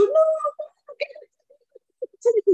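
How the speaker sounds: high whiny protest; high whining child's no. Retold or imitated. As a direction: whine no like a small child.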